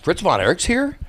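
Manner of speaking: high, rising disbelief; surprised question. Retold or imitated. Young fan asks the concession girl about Fritz, surprised. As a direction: ask it like an astonished fan. Fan learns his idol is there.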